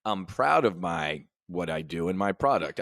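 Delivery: ordinary talk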